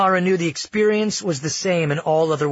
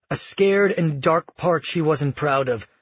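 ordinary delivery

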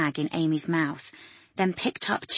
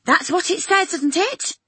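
high, louder anxious woman; high patient voice; 12 semitones higher, 7 dB louder than usual. Acted. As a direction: raise her voice high and worried.

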